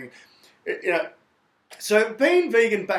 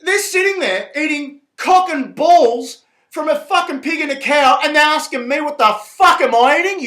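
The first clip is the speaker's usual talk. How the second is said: real rising anger, louder and higher; ranting furiously at the meat eaters, voice raised; getting angry; 7 semitones higher, 8 dB louder than usual. Real, not acted. Vegan ranting at meat eaters who mocked him, real loud anger. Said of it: "I know, I'm getting angry".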